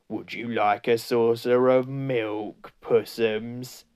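slow, mildly taunting creature voice; lightly voiced mocking follower; jeering. A narrator's reading. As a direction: offer it with drawn-out fake sweetness. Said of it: "jeering at him".

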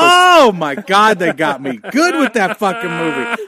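high, loud, choked-up exclamation; emotional outburst. Real, not acted. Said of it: "I'm tearing up".

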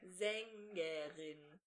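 slow mouthing. Shown as a demonstration. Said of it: "mouthed slowly".

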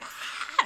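high breathy gasp of dread; mock gasp. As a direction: gasp like the news just hit you. Doomscrolling panic reaction.

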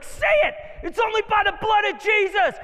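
high, full-force preacher's yell; commanding shout. Retold or imitated. Friend confronting the demon in the guy, shouting. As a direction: shout it down like an exorcist.

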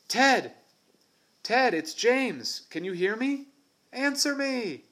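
high, loud shout, slowing on his name; shouting again. Acted. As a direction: yell into the trees, growing desperate. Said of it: "then shouted again".

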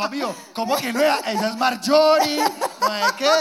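a mother scoffing, loud and up; loud mock mom retort. Mom retorts, voiced loudly.

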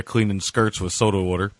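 ordinary talk, nothing marked

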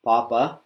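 papa with a tight p and a rising end; papa, breath held, lifting at the end; restrained papa, rising. Shown as a demonstration.

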